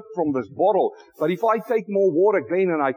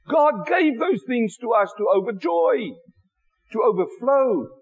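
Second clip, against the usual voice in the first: usual speaking voice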